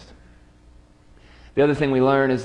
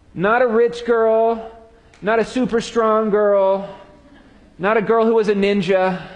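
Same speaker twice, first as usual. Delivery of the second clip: incredulous challenge; 8 semitones higher than usual. Acted. Preacher to Peter, cowering before a servant girl: rising disbelief. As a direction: demand to know how he could cower.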